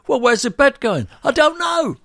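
high, loud acted back and forth; hammed-up frazzled dialogue; mock exasperation. Builder quizzing homeowner, high loud mock exasperation.

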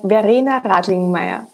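Verena Radlingmeyer said the German way, rolled and open vowels; German pronunciation. Shown as a demonstration. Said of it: "In my original German accent".

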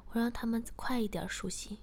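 ordinary delivery, nothing marked

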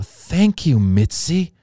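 thanks that start high and drop back; thrilled thanks. Retold or imitated. Young comic just made a regular, thrilled thanks. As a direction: gush it, punching the first words.

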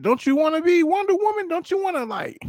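pleading in a squeaky high voice; high coaxing pitch. Retold or imitated. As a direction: sweet-talk the wife into it, way up high.

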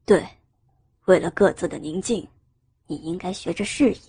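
low-pitched male indifference; detached man's voice, no comfort given; cold, indifferent. A narrator's reading. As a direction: answer her coolly in a deep register. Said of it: "冷淡地回应他的疑问".

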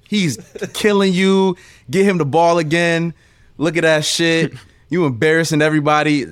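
mock the defender gleefully; trash talk. Taunting Josh during pickup, laughing trash talk. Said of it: "I am talking endless shit".

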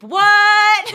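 high, loud hype; hype shout. Real, not acted. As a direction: shout it like a rap hype man. Hyping the rap.

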